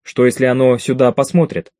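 ordinary delivery, nothing marked

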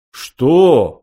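loud shocked what; stunned exclamation. A narrator's reading. Slartibartfast stunned by the news of the native. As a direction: burst out stunned. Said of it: "воскликнул ошеломлённый".

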